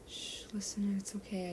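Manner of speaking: whispered, gentle shushing; calm her softly; hushed soothing. Acted. Levi soothing injured Listener, hushed whisper. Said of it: "I calm".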